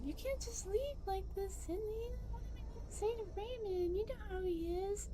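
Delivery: put-on young Duncan. Young Duncan begging his friend not to leave, high and pleading. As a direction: plead like a scared kid.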